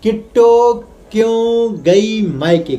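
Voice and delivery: drawn-out, announcer-style vlog title; slow title announcement. Shown as a demonstration. Vlogger greets viewers, then slowly announces title. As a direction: stretch out each word like a headline. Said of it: "दोस्तों, कैसे आप सब लोग?".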